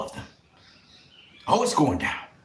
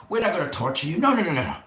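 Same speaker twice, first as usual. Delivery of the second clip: ordinary talk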